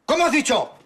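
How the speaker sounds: loud, high outraged yell; father roaring at his son; furious shout. Acted. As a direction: explode at the answer. Father explodes at the reply.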